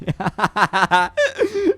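high burst of laughter; amused laugh. Real, not acted. Player spots someone sitting there, amused laughing. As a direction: laugh out in a quick run.

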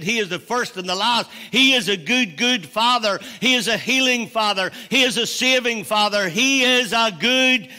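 loud, high, driving preacher's cadence; full-force preaching. Real, not acted. Preacher rousing the congregation to praise God, full-force preaching. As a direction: build a rousing litany of praise.

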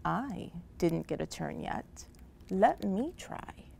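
very soft little voice; small, faraway-sounding plea; tiny voice. A narrator's reading. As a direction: speak up timidly, slowing at the end. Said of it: "they heard a tiny voice".

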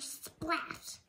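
ordinary delivery, nothing marked

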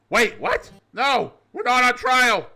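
high, loud startled interjection; startled second voice; surprised. Acted. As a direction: cut yourself off in surprise. Self-interruption in other voice.

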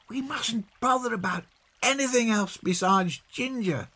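put-on very high child's scolding; reproving. A narrator's reading. Peggy scolding John about the search, reproving. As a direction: tick him off in a high little-girl voice. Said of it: "said Peggy reprovingly".

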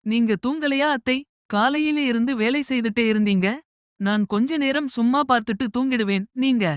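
lightly voiced young woman, gentle; young bride voice.

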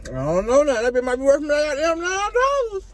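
talking with food in mouth; mid-bite mumbled speech; chewing while talking. Real, not acted. Seen 1: Holds foil-wrapped burger near mouth, lips working as if chewing.

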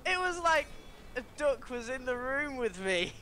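high-pitched, giggly disbelief; real laughter lifting the voice high; amazed through laughter. Real, not acted. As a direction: marvel while cracking up. Amazed, laughing at duck impression.

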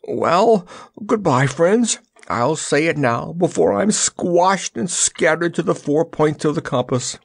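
high-pitched, lamenting lion; mildly wailing lion voice; alarmed lion. A narrator's reading. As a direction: bid a dramatic, doomed farewell. Lion's alarmed farewell before the crash.

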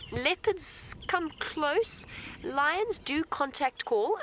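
ordinary talk, nothing marked

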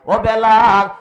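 loud drawn-out call of a name. Acted. Companions call Bilal in story.

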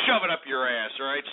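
ordinary talk

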